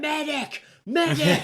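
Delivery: high, urgent mock shout; imitated cry for help. Woman calling help for collapsed fan, urgent shout. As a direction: act out calling across a hall for a medic. Retelling calls for a medic.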